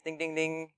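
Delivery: ding it like a ring bell; bell imitation. Preacher imitates the round bell, ding ding ding.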